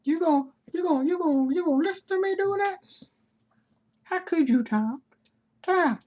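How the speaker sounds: high, loud hurt whine; mock outrage. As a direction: whine like a wounded diva.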